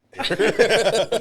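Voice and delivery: a loud, bursting laugh. Real, not acted. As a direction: laugh out hard at the joke. Big laugh at race joke.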